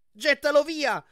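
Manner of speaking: high, loud urgent command; loud panicked order; urgent shout. Acted. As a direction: yell it in panic. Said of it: "gridò Annabeth".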